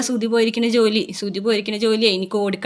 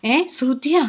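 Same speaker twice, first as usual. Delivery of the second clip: surprised; 6 semitones higher, slower than usual. Retold or imitated. Mother surprised at daughter-in-law's name.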